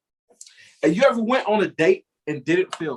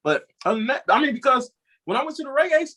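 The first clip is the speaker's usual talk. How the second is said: normal talking voice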